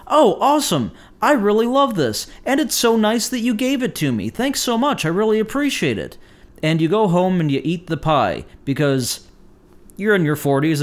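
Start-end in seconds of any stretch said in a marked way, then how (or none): 0.1-6.2 higher-pitched, gushing polite thanks; acted grateful delight. Thanking someone for a surprise pie, acted delight. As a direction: play up being thrilled with the gift.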